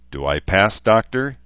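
peevish, whiny question rising high on Doctor; sulky complaint dressed as a joke; querulous. A narrator's reading. Weary patient asking the doctor, querulous and peevish. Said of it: "he asked querulously".

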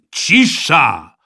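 loud command for silence; shouting. A narrator's reading. Red-faced supervisor silencing the boys, shouting. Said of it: "krzyknął Rosół".

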